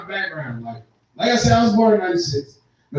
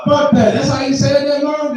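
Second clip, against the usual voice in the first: mock outrage; 6 semitones higher, 3 dB louder than usual. Outraged at mom.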